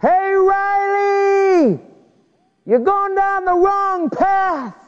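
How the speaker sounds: long slow high call from far off; distant shout. Retold or imitated. Preacher replaying the youth director's warning, long high shout. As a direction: stretch it out like a warning from afar. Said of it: "I want you to hear the warning".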